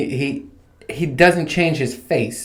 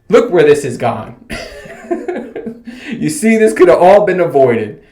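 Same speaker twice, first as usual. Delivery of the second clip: laughing through the words, louder; breaking into a high laugh mid-sentence; 6 semitones higher, 3 dB louder than usual. Real, not acted. Vlogger laughs telling viewers about the rude clerk, laughing. Laughing at his own anger.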